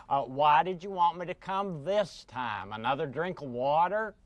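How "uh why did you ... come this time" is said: ordinary talk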